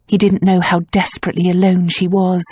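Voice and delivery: plain reading voice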